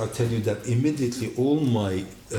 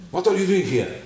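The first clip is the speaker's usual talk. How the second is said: hostile father; 8 semitones higher, 5 dB louder, faster than usual. Retold or imitated. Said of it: "he was acting".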